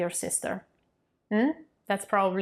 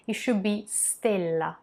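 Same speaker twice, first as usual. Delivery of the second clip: ordinary talk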